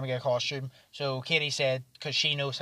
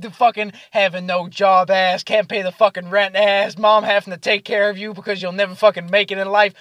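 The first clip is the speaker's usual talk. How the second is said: loud, raised-pitch string of insults; imitated roast; 4 semitones higher, 8 dB louder than usual. Drunk friends roasting each other at the table, mock-aggressive. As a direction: reel off mock insults with force. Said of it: "start roasting each other".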